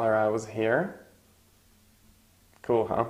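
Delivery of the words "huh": usual speaking voice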